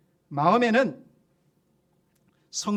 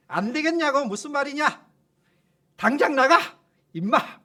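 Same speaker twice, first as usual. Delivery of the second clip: higher and louder, a sudden angry bark; angry outburst; 6 semitones higher, 5 dB louder than usual. Retold or imitated. Pastor losing his temper at demon, angry outburst. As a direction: snap at him to get out now. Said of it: "버럭 질렀는데".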